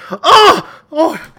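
high, loud swooning gasps; fainting gasp. Retold or imitated. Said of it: "The men are always like".

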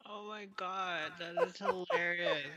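웃음 섞인 감탄; laughing while talking. Real, not acted. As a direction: crack up while you say it. Reacting to the joke.

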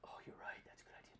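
breathy hushed agreement; whispering agreement mid-challenge. Real, not acted. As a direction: whisper it under your breath. Whispering during spicy chip.

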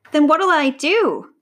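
loud, high, drawn-out question; exasperated growl. A narrator's reading. Lion told he cannot fight, exasperated growl. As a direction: throw the question back, stretched out. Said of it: "growled Eli".

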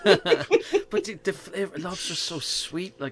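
high, loud laughing protest. Real, not acted. Protesting to friends about butter, laughing. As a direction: laugh the words out in disbelief.

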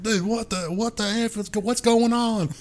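high mock exasperated friend; annoyed friend. Friend in the car asking what's going on, mock high exasperation. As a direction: play the friend, baffled and fed up. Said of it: "He's like".

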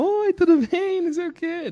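breeze in with an exaggerated sing-song greeting; mock cheery greeting. Young man greeting the tribunal staff, exaggeratedly cheery.

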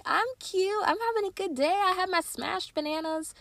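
put-on very high, squeaky baby voice; happy baby voice. The cute baby bragging about her day, squeaky. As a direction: chirp it like a giddy toddler. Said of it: "was like".

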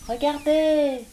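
cri aigu de petit lapin; voix très haute de lapin; lapin qui s'écrie. A narrator's reading. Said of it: "s'écria un des lapins". (un lapin s'écrie, voix très aiguë)

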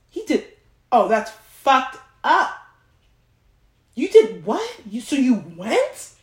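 high, swooping gasps of disbelief; friend's shocked reaction. Retold or imitated. Imitating her friend hearing the date story, shocked. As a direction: play the friend reeling in shock. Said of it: "this is going from a".

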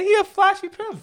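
high, loud hype squeal; excited squeal. Real, not acted. Cohost hyping up a flashy character, squealing excitedly. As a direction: shriek it out in delight.

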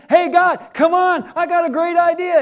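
high, loud, excited pitch to God; eager calling out. Acted. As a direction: holler up with a big idea.

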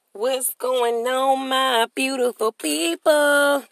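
slow, high sing-song hello; host greeting. Acted. Host warmly greeting his podcast listeners, slow and high. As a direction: draw out the welcome to the crowd.

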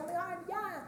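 high, breathy delight; excited. Retold or imitated. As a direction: gush it up high and soft. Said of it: "And I was like".

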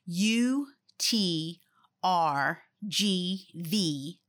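slow, spelled out letter by letter; over enunciating. Shown as a demonstration. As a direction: spell each letter out slowly and clearly. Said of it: "let me say it slowly for everybody".

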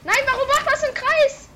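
very high, loud outcry; surprised shout. Real, not acted. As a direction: shout in disbelief. Game glitch surprises him.

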